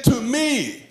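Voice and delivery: "me" high and drawn out; high, emphatic "me" with a late fall; stress on ME. Shown as a demonstration. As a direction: swing all the weight onto "me". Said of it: "emphasizing different words of the phrase".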